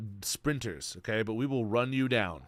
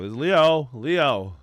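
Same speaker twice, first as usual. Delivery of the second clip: excited recognition; 2 semitones higher, 8 dB louder, slower than usual. Real, not acted. Spotting his friend's post, hyped and loud.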